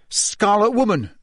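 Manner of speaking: SCARLET WOMAN blurted high, falling at the end; scolding hiss. A narrator's reading. Ron finds the word for Hermione, scolding blurt. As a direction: blurt the awkward label as an accusation. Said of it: "Ron hissed at Hermione".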